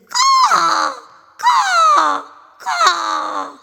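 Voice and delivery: very high, loud crying caws; a mother crow crying out in grief; wailing crow caws. Acted. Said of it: "She started weeping".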